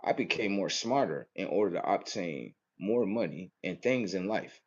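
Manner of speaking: soft, quiet voice of a thoughtful rich man; a hint of a reflective wealthy man; wealthy person musing. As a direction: mimic a rich guy thinking it over softly.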